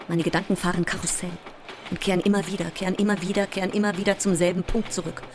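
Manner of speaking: fast, insistent repetition without a breath; racing, circling thoughts. Acted. Sleepless actress describes her looping thoughts, racing and agitated. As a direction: rattle it off like a spinning carousel.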